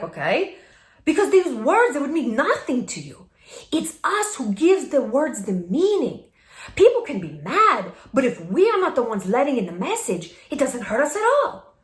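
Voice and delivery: imitated Mexican Spanish accent; Salma Hayek. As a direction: put on a Mexican accent, emphatic. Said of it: "I'll do it in her accent".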